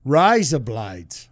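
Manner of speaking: put-on Australian accent. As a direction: copy the Australian vowels, drawn out. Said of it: "in Australian".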